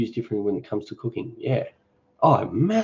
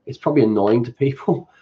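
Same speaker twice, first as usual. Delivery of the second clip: usual speaking voice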